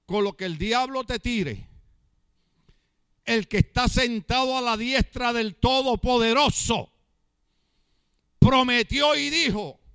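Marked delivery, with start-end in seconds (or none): 3.3-9.8 loud, driving sermon voice; fiery preaching. Acted. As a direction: preach it out to the whole church. Pastor preaching at full force.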